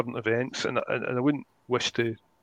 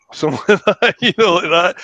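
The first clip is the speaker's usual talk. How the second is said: laughing; 7 semitones higher, 11 dB louder than usual. Real, not acted. Laughing at own crude example.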